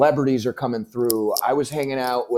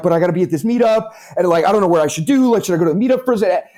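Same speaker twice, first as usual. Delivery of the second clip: frantic; 7 semitones higher, 3 dB louder, faster than usual. Retold or imitated. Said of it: "and I'm like".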